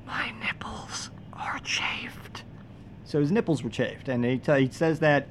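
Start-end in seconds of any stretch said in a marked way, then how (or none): 0.1-2.4 slow, high whisper like a voice from above; ghostly whisper. Retold or imitated. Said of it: "voice actually goes".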